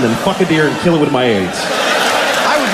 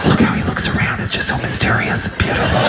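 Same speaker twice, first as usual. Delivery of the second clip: hushed, reverent mock whisper; mocking gushy nature-lover whisper; awestruck whisper; 2 semitones lower, faster than usual. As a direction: whisper in exaggerated wonder so the deer won't startle. Everybody mimicked marvelling at a deer.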